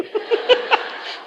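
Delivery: airy unvoiced chuckle; breathy laugh. Real, not acted. Preacher laughs at his own joke, airy chuckle.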